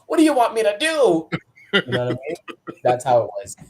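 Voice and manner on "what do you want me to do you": high, loud mock frustration; exasperated plea. Rookie pleading with agent over instructions, exasperated. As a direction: cry it out at wits' end.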